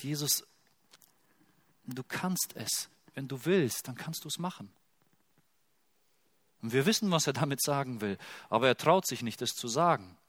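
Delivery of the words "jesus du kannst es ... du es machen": leise und verzagt. Retold or imitated. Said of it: "der einfach nur sagt".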